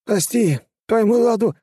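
high, loud lisping plea; pleading lisp. A narrator's reading. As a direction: beg through broken teeth. Beaten enemy begs, lisping.

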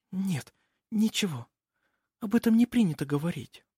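put-on high, soft, evasive girl; hushed, high, reluctant maiden; timid young girl. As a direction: back off gently in a girlish voice. Nikola withdraws her words.

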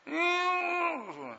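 high put-on crying whimper; a little sobbing hum; wife's whimper. Upset wife whimpering to husband, high crying hum.

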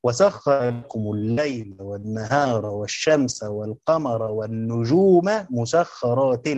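each word drawn out, slow and measured; slow verse reading. Shown as a demonstration. Teacher reciting the verse slowly to the class. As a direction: lay out the verse slowly for the students. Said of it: "خلي بالكم عشان نذكر هنا فايدة".

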